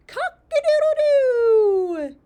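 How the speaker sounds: loud, high crow with a long last note; rooster crow. Acted. Reader shows a child what roosters say, full loud crow. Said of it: "And they say".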